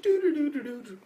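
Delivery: high-pitched rapid "doo-doo-doo"; mimicked doodling sound. Guy just sitting on his computer, high mimicked doo-doos.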